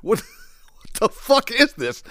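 high, stunned, half-laughing; incredulous squeak, laughing through it; baffled disbelief. Retold or imitated. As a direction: do a shocked double take at the stage. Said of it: "I'm like".

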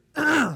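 sharp loud throat clear. Real, not acted. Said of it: "I'm losing my voice here".

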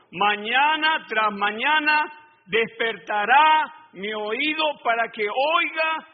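each word drawn out slowly; slow, weighty verse read to the congregation; slow scripture reading. Shown as a demonstration. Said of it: "Mira, hermano".

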